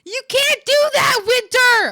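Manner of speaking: high, very loud scolding; loud shrieked telling-off; scolding shout. Real, not acted. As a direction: yell it at a misbehaving friend. Scolds Winter for self-banning.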